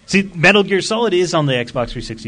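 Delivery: usual speaking voice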